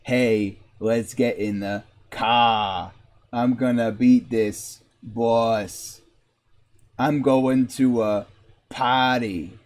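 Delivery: low mock Boston accent; hammed-up low Massachusetts voice. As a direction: imitate a Massachusetts local, dropping the r's. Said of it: "It's 'cause I'm from Massachusetts".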